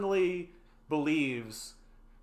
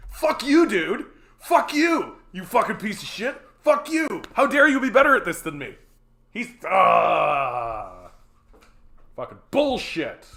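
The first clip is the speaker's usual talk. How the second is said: screaming mock-jealous curses, high and loud; swearing tirade; 4 semitones higher, 9 dB louder than usual. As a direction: yell curses at him in mock envy. Jealous mock rage at YouTuber.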